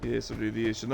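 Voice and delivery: ordinary talk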